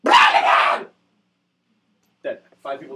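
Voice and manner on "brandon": loud unvoiced yell; mock battle cry. Gunman firing the rocket, loud battle cry. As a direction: yell it as you fire. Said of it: "just go".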